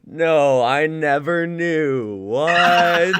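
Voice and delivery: loud, high mock disbelief; shocked wife. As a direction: stretch "I never knew" slowly in mock shock. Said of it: "was just like".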